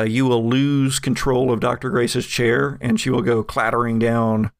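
usual speaking voice

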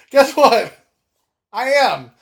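loud, high comeback; playful loud retort. Real, not acted. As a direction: fire it back with a grin.

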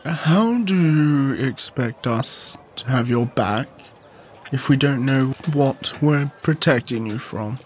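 low, slow, weary questioning; grave, measured demand for answers; serious Charlie confronting. Acted. Player voices Charlie confronting Abella.

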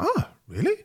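high, soft surprised reaction; retold surprise. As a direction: replay your own surprise, pitched high. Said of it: "I was like".

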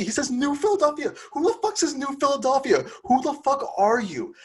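ranting in high-pitched disbelief; incredulous outrage. Real, not acted. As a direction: scoff at the absurd name in outraged disbelief. Incredulous rant about the executive.

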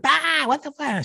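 high, pained mock wail; imitated crying yelp. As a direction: cry out like a stung child. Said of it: "She's crying shit".

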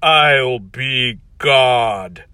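very slow, menacing declaration; crazed evil villain. Acted. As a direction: savor each word like a mad god. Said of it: "looks crazed and angry and evil".

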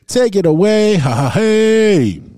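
loud, high, slow cheer; hype handoff. Real, not acted. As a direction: cheer him on. Handing over to co-host.

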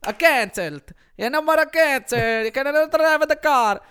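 loud, high hammed-up Italian accent; horrible Italian accent. Podcaster mocking Italy's lockdown in a bad Italian accent. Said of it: "I'm a horrible Italian accent".

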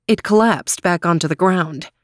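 usual speaking voice

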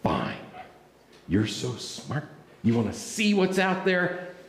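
low, grudging sarcasm; sarcastic giving in. Acted. Fisherman gives in to Jesus, grudgingly sarcastic. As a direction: concede with sour reluctance. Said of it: "that's the attitude that Simon had".

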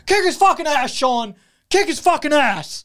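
high mock yell of an angry old dad. Dad yelling at his son from the passenger seat, high. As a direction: bark it like the psycho dad.

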